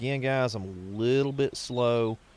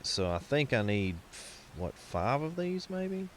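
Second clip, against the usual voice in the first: ordinary talk, nothing marked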